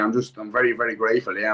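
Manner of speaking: ordinary delivery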